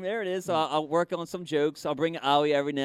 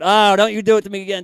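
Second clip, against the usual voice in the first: loud, fast protest; mock panic; 3 semitones higher, 11 dB louder, faster than usual. Spectator begs the magician not to repeat it, loud mock panic. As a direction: burst out in hammed-up disbelief. Spectator dreads another trick.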